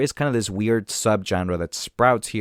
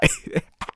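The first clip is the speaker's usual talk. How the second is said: breathy laugh. Real, not acted. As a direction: let out an airy laugh. Breathy laugh after the joke.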